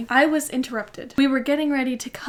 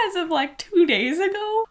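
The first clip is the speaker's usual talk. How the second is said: much higher, louder disbelieving question; incredulous; 7 semitones higher, 3 dB louder than usual. Real, not acted. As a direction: laugh at your own past self in disbelief. Mocking her own childish wish.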